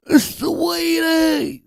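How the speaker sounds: playful British accent; mock London accent. Shown as a demonstration. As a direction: sing out sweeties like a Londoner. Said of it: "I gotta say it like I'm from, um, London".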